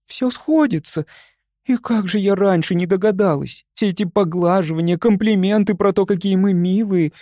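high, excited rush of realisation; agitated. A narrator's reading. Люба realising why the hosts pet them, agitated. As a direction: let the pitch ride high with discovery. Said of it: "взволнованно воскликнула она".

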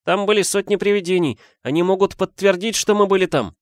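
put-on high, eager child's protest; оправдывающийся школьник. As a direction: explain eagerly, voice pitched high. Дети наперебой оправдываются. (высоким голосом, горячо оправдываться)

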